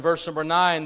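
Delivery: usual speaking voice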